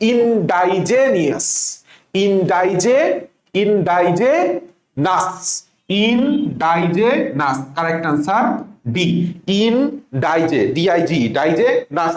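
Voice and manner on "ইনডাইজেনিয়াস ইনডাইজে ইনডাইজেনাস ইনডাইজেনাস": ইনডাইজেনাস drawn out slowly, ডাই stressed; spelling pronunciation. Shown as a demonstration. Teacher shows students how to read ইন্ডিজিনাস, slowly. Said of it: "কিভাবে পড়বো".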